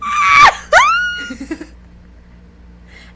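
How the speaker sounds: very high, loud shriek; excited scream. Retold or imitated. Reacting to hearing she works in casting, excited scream. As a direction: shriek as if hit by huge news. Celebrity learns she's in casting.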